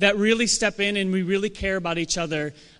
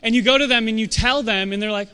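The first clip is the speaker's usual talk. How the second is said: normal talking voice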